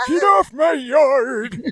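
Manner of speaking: high, slow, hammed-up yell; mock angry shout. Host playing homeowner chasing off visitor, mock shout. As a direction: holler like a cranky yard owner. Acted yard-owner joining the voices.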